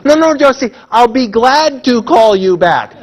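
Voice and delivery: loud, slow teasing correction stressing glad; mock correction. Audience jokingly correcting a caller, loud and slow. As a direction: chant it back like a whole room correcting Josie. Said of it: "we're all gonna say".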